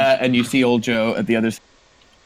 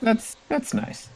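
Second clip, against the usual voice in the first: high, slow, soft wizard voice; polite wizard; 14 semitones higher, 5 dB quieter, slower than usual. Acted. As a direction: draw it out softly, pitched right up. Wizards politely answer the monk.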